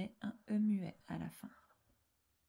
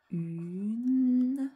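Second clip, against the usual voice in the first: "Une." stretched long, rising higher and much louder; drawn-out word; 4 semitones higher, 11 dB louder than usual. Shown as a demonstration. Teacher recalling a word learnt by heart, drawn-out word. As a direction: swell on "Une.", holding vowel and N. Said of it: "le petit mot une".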